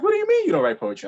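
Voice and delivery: very high, fast disbelief; incredulous. Retold or imitated. Girlfriend doubting he writes poetry, high and fast. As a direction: fire it back in disbelief. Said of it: "She's like".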